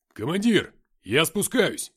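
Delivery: loud, high call from a distance. A narrator's reading. Fighter calls down the stairwell. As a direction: shout up to the commander.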